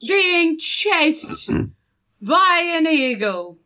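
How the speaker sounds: high, slow, emphatic protest; exasperated protest. Acted. Simon protests to Jeff about the eagle chase, exasperated. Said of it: "Simon says, looking at Jeff".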